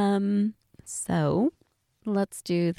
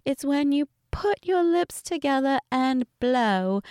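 higher-pitched mock British explanation; Peppa Pig; 4 semitones higher than usual. Voicing Peppa explaining whistling on the phone, fake British accent. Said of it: "I'm bad at the accent".

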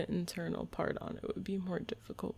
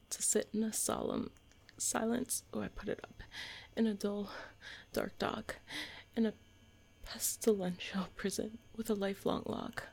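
ordinary talk, nothing marked

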